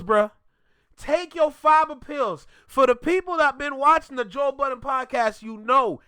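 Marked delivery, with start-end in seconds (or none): 1.0-2.5 "fiber" stressed, each word spaced out slowly; slow deliberate repeat. Real, not acted. Repeating advice to Rory, slow and deliberate.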